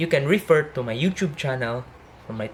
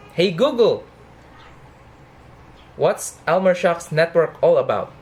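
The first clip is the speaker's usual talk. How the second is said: voice command; 3 semitones higher, 3 dB louder than usual. Real, not acted. Talking to voice assistant.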